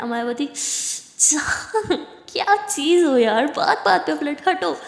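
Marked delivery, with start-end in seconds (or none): 1.2-4.7 playfully exasperated. Acted. Exasperated wife pushing her away.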